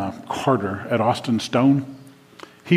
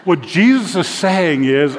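higher, louder and slower to drive it home; emphatic; 6 semitones higher, 5 dB louder, slower than usual. Real, not acted. Preacher driving his point home to the congregation, emphatic. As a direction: lean in hard on the key point.

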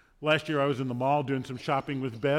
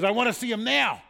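put-on higher, louder child's demand; demanding kid; 7 semitones higher, 5 dB louder than usual. Preacher imitating kid in the Santa line, demanding yell. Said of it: "a kid causing a little bit of a ruckus".

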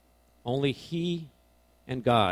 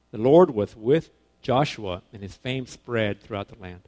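slow, much louder start, weight on Lord; solemn scripture reading; 5 dB louder than usual. Acted. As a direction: read the verse like a proclamation. Verse re-read solemnly.

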